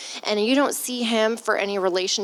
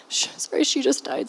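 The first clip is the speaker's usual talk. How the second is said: a real, tearful whisper; breaking down, barely voicing the words; choked-up whisper; 5 semitones higher than usual. Real, not acted. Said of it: "I'm emotional today".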